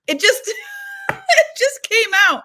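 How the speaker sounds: high, loud burst through laughter; laughing. Real, not acted. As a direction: squeal it out, breaking into laughter. Laughs at her own blurted confession.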